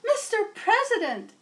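Madam Secretary alerting the president; urgent secretary. Acted. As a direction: call him like a nervous aide.

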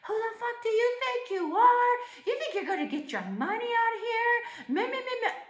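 very high, fast mocking tirade; enraged mock rant. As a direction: imitate his rage as a squawking, high-pitched rant trailing into babble. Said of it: "he got enraged".

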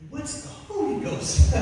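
breathy laugh through the question; mock bewildered chuckle. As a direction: giggle in disbelief. Laughing disbelief.

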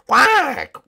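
high, drawn-out imitated quack; indignant penguin quack. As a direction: squawk one protesting quack.

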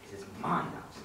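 low, soft, with a rise on "my"; hushed, low territorial warning; quiet possessive claim. Acted. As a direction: murmur it low, leaning on "my". Villain claims territory, softer first take.

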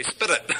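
"spit it" for spirit, an affected tapped "SPIDDIT"; put-on accent turning spirit into "spit it". Preacher mimicking Greek and Hebrew professors, affected "spit it". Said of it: "say it just with an accent".

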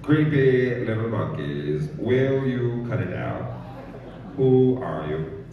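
slow, measured screen-legend voice; attempted celebrity impression, very slow; Morgan Freeman. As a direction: draw each phrase out with weighty authority. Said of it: "How about Morgan Freeman".

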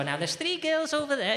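high-pitched game show hostess. Acted. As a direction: introduce the contestants in a high voice. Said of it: "you'd have Scylla come out".

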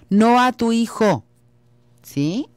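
usual speaking voice

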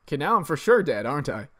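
usual speaking voice

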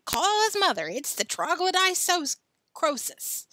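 high, loud, melodramatic cry; hammed-up emergency call; mock alarm. Joe pretending Billy is dying of pepper, mock alarm.

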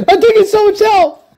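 high, panicked yell; panicked shout. Real, not acted. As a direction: yell for help under fire. Taking damage in a fight.

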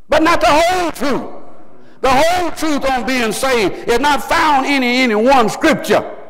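high, driving pulpit delivery; full-force preaching. Real, not acted. Preacher warning the congregation of half-told truth, impassioned. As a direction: preach it with mounting insistence.

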